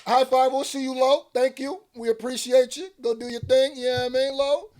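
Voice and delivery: high, loud, fast mock label voice; mock label exec. Mocking the label thanking [name], high and loud. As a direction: imitate execs rushing him out. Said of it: "They said".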